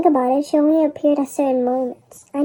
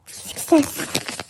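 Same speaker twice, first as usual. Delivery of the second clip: whispered success; 6 dB quieter than usual. Acted. Whispered triumph to self.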